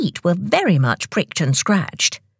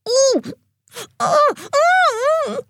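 high squealed "ooh" and gasps. A narrator's reading. Tock with ice down his neck, squealing. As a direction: yelp in short, high bursts. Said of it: "Tock squealed".

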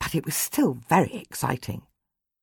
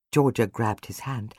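ordinary delivery, nothing marked

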